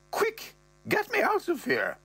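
high falsetto mother; mother's voice. Retold or imitated. Mother calling from the pool, high falsetto. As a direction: play the mum in a high put-on voice. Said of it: "She goes".

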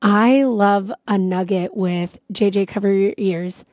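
ordinary delivery

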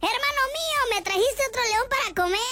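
high-pitched feigned delight; mock surprised shout. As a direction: greet the monkey high, in put-on surprise. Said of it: "le grita de sorpresa". (grito agudo de sorpresa fingida)

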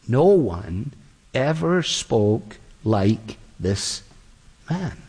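very slow, low and hushed in wonder; awed, slow officers. Acted. Officers answering the Pharisees, slow and awed. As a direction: draw each word out in awe.

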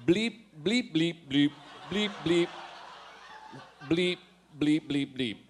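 hammed-up robot bleeping. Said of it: "I am a robot now".

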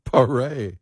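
PARÉ with the stress on a final "ay"; French pronunciation. Shown as a demonstration. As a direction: say PARÉ as "puh-RAY", Frenchified. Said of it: "oh, he's French".

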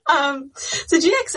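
laughter fading into a question; laughing. Real, not acted. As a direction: catch your breath from laughing. Still laughing, restarting question.

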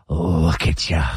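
low, scornful jeer of a dad; dad's mocking taunt. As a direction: sneer it at the kid like a disgusted father. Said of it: "Do the impression".

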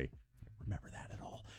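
whispered, almost inaudible; hushed whisper of disbelief; whispered aside. Real, not acted. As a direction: breathe it to yourself in disbelief. Stunned aside after hearing story.

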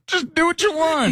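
squeaky carefree permission; airy high-pitched invitation; high mock encouragement. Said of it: "just say".

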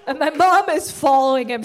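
ordinary talk, nothing marked

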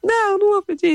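very high, whiny imitated refusal; tearful child's refusal. Daughter refusing to apologise to the waiter, imitated tearful whine. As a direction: refuse like a child about to cry. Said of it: "começou a chorar".

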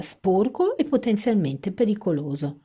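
ordinary delivery

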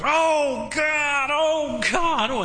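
imitated high, straining prayer shout; mock a carried-away young preacher wailing to God; straining vocal cords. Preacher imitating a young preacher at all-night prayer, straining shout. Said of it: "straining the vocal cords".

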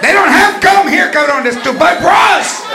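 mother yelling at her son; mock shouting mother. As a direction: tell the kid off in the store. Mother scolding kid in store.

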